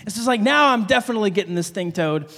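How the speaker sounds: ordinary talk, nothing marked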